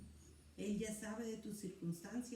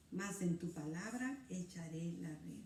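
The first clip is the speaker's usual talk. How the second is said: low, slow and solemn; reverent resolve; 5 semitones lower, 4 dB quieter than usual. Acted. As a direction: speak it as a quiet vow of faith. Pedro's reverent answer quoted.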